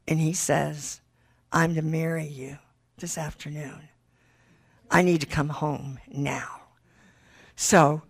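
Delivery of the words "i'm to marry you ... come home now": quietly certain, much softer; a lightly voiced husband, hushed; hushed resolve. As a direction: say it low, almost a whisper.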